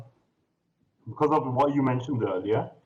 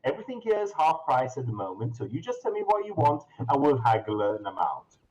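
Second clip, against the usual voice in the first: higher, fast saleswoman patter; brisk shopkeeper; 6 semitones higher, faster than usual. Acted. Shop lady pushing her half-price wares, fast and higher. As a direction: rattle off the sales pitch.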